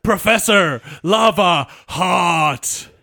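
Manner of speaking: put-on loud, strained old-man shout; hammed-up old professor shout; Professor impression. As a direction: bark out each word like a cranky old scientist. Quoting Professor's best line.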